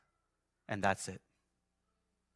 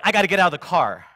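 loud, quick, higher burst of urgency; retold panic; 3 semitones higher, 7 dB louder, faster than usual. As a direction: blurt it out fast like someone freaking out. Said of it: "And I'm freaking out".

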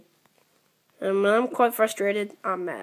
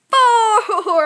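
high, loud thrilled shout of the number; excited cry; 10 semitones higher, 10 dB louder than usual. Real, not acted. Gamer yelps as the tower nearly reaches five, excited. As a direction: yelp it out as the score lands.

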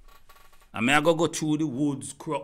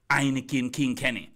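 ordinary talk

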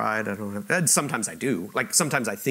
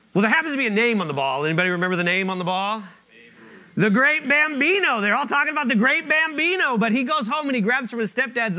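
higher, louder storytelling from the pulpit; lively preaching; 12 semitones higher, 5 dB louder than usual. Acted. Preacher telling The Sandlot to the church, lively and louder. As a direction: build the story with rising energy.

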